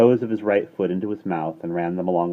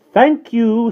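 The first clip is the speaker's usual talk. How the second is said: meaningful thank you; 13 dB louder than usual. A narrator's reading. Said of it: "said Mary Poppins meaningfully".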